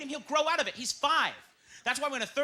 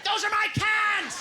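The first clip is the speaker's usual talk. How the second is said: slow, high yell; junkie shout; 12 semitones higher, 5 dB louder, much slower than usual. Acted. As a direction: howl it like a crazed junkie.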